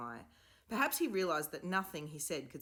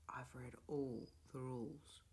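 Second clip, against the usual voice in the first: soft whisper, barely voiced; murmured; 3 semitones lower, 8 dB quieter than usual. A narrator's reading. Stanley murmurs his puzzlement to the others, whispering. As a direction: keep it under your breath. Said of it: "murmured Stanley".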